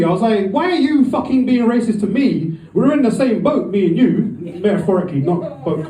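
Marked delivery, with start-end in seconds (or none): 0.5-4.4 angry retort. Retold or imitated. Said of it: "but I was angry".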